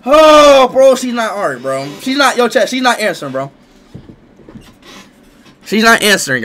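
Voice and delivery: loud, high anxious yelling; frantic shouting. Real, not acted. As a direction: shout it at chat, panicking. Panicking to chat, shouting.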